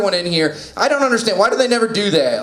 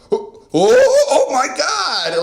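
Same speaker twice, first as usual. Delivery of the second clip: shocked shopper; 13 semitones higher, 3 dB louder, much slower than usual. Acted. Toy store woman shocked at doll.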